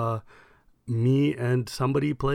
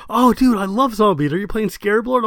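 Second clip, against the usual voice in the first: higher and louder, bubbling over; eager fan; 8 semitones higher, 6 dB louder than usual. Retold or imitated. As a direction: pile on the fan enthusiasm. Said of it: "He's like".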